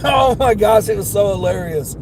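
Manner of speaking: ordinary talk, nothing marked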